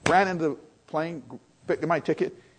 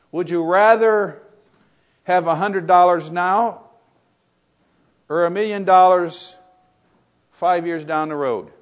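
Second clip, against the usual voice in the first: posed question; 3 semitones higher, 11 dB louder, slower than usual. Retold or imitated. Quoting a question to the crowd.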